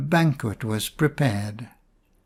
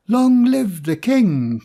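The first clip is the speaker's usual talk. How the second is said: high, loud, drawn-out hail; crowd cry; 8 semitones higher, 5 dB louder, slower than usual. Acted. People greeting Adam again, loud high cheer. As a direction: shout it out like a whole cheering crowd. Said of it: "cried the people again".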